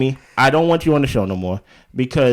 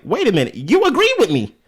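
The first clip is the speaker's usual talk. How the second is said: mocking Stormy; 8 semitones higher than usual. Mocking Stormy's playing dumb.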